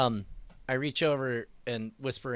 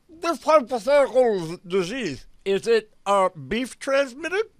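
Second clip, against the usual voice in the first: louder, muffled through a mouthful of food; mouth full; 7 semitones higher, 6 dB louder than usual. Acted. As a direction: speak with your cheeks stuffed. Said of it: "Don't talk with your mouth full".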